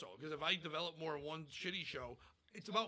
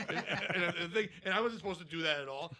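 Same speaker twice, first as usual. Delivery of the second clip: laughing; 2 semitones higher, 9 dB louder than usual. Real, not acted. Laughs through a story.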